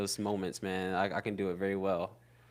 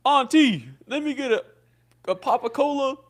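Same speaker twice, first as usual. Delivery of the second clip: put-on much higher, eager kid voice asking for candy; little kid asking; 12 semitones higher, 9 dB louder than usual. A kid asking the corner store auntie for a Papa Cola, high and eager. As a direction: squeak it up like a kid at the counter. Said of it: "and we used to say".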